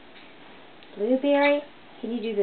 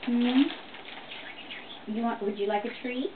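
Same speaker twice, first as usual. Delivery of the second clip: usual speaking voice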